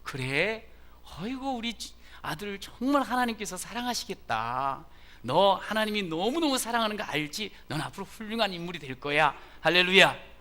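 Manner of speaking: lavish the son with pride; mother delighted her son is at church; delighted praising mother. Retold or imitated. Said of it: "이렇게 이야기해야지 정상인".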